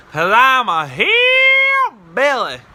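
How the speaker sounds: high, loud, stretched-out twang; hammed-up hillbilly. Prankster up a tree to amazed cops, hillbilly holler. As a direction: draw it out into a yokel's holler.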